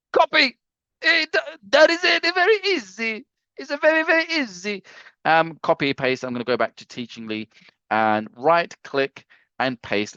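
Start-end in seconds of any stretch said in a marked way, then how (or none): 0.1-4.8 high, silly cartoon voice; comic put-on voice. Host showing how easy copying is: high silly put-on voice. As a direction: chirp it up high like a cheeky puppet.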